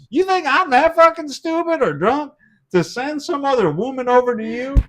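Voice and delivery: high-pitched protest of innocence; retold indignant defence. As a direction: argue it high, as if wrongly accused.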